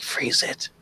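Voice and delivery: whispered urging in character; conspiratorial whisper. Acted. Kid secretly urges player to freeze the flower, whispering.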